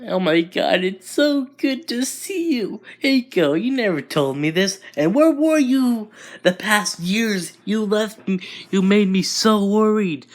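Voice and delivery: high-pitched, excited gushing friend; overjoyed friend fussing in a high voice; gushing reunion. Acted. Friend gushes at long-awaited reunion.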